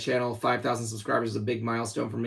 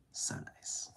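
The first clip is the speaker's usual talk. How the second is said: whispering; 17 dB quieter than usual. Real, not acted. After a drink, whispered relief.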